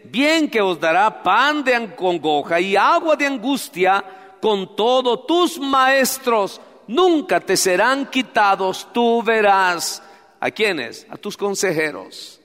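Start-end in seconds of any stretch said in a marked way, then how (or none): 0.0-10.0 loud slow scripture reading; preaching. Acted. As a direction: proclaim it from the pulpit. Reads scripture with force.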